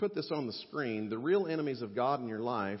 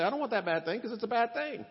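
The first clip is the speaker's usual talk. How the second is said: much higher and louder, hammed-up refusal; mock everyman voice; 12 semitones higher, 5 dB louder than usual. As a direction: squeak it out like a caricature of the listener. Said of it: "you say".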